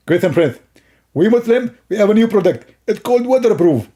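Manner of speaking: high, lisping character voice; comic opponent. Acted. Opponent announcing a new product, high lisping voice. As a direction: pitch it up into an eager, silly lisp.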